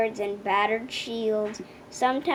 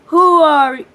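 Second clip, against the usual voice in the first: dragon roaring; 2 semitones higher, 10 dB louder than usual. A narrator's reading. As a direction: blast the question at her. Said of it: "roared the dragon".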